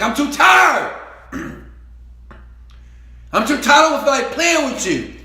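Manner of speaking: put-on shouted high and loud, fed up with the kids; mock irritated shout. Worn-out father shouting at his kids to leave him alone, loud and fed up. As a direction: bark it like a worn-out dad brushing off his kids. Tired parent snapping at kids.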